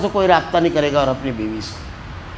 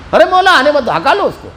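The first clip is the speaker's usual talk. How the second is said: louder, higher command to keep him out; firm loud order; 8 semitones higher, 3 dB louder than usual. Retold or imitated. Maulana to the elder about the husband, louder order.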